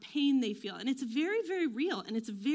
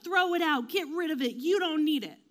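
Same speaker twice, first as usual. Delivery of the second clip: mock-urgent ordering, louder and higher; impatient family; 5 semitones higher, 6 dB louder than usual. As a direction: push it out like fed-up relatives. Acts out impatient family shouting.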